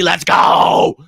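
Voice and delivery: loud, high rallying yell; hype shout. Real, not acted. Hyping up the chat.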